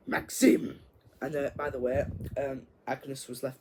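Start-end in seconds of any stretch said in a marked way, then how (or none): 0.1-0.8 shocked gasped name. Retold or imitated. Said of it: "he's like this".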